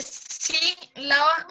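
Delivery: "sílaba" slowly broken as sí, then laba; split in two. Shown as a demonstration. Student explains a classmate's wordplay, splitting sí from lava. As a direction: pause after the SÍ in "sílaba". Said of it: "está separando la sí de lava".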